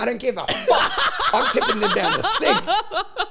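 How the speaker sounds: high laughing delivery, drawn out; laughing defiant rant. Retold or imitated.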